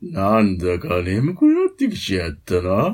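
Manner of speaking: low crooner voice, parody of a mimic. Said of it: "[name]のモノマネのモノ".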